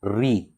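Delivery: री said as a rolled r plus long ee; pronunciation demo. Shown as a demonstration. Teacher showing how the letter is pronounced, री said as "ree". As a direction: sound out री as "ree". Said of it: "इसका उच्चारण कैसे हम करते हैं".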